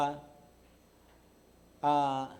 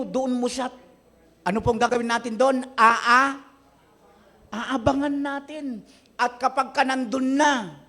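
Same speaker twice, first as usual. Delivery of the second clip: high, loud, driving sermon voice; preaching at full force; 8 semitones higher, 6 dB louder than usual. Acted. Pastor pressing the congregation about an enemy, full-force preaching. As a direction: push the story hard at the congregation.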